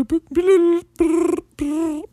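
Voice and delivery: high-pitched ringtone beeps; phone ringing. Pretend. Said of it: "who's calling me?".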